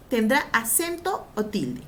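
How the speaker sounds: ordinary delivery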